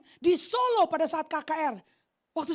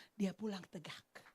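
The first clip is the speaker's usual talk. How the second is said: awed whisper; 8 semitones lower, 15 dB quieter, slower than usual. Acted. Healing punchline of the story.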